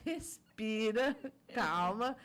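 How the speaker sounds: slow, stretched words with firm emphasis; drawn-out calming instruction. Retold or imitated. Mother telling her son to breathe, slow and emphatic. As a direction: draw each word out to slow someone down.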